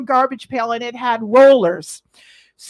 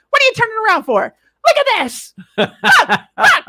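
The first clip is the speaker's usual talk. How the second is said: playful boss; 9 semitones higher than usual. Retold or imitated. Boss posing in boxers.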